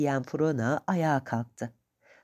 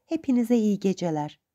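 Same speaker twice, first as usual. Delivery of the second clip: ordinary delivery, nothing marked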